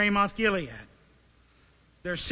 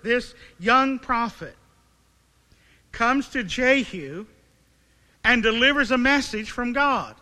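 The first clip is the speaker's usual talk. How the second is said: preaching; 5 dB louder than usual. Acted. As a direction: drive each phrase home from the pulpit. Preaching the climax at full force.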